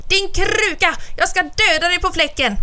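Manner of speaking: beside himself with rage; raging scream. A narrator's reading. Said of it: "utom sig av raseri och skrek". (skriker av raseri)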